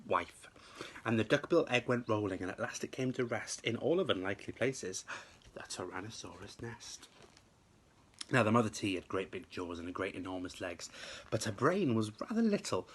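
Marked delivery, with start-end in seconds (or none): none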